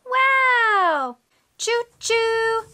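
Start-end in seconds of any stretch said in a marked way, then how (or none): 1.6-2.6 high, sustained train hoot; train whistle imitation. Shown as a demonstration. Showing a toddler a train sound, high whistle. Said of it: "Can you do that?".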